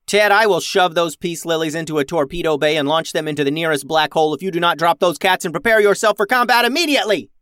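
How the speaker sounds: rapid rant building to a high shout; exasperated captain losing patience; yelled threat. A narrator's reading. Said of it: "yelled Max".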